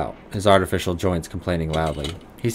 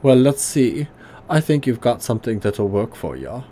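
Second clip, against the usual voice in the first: put-on gruff German accent, pitched higher; 4 semitones higher than usual. Old tinkerer greeting the player in a higher gruff German accent. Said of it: "high German voice".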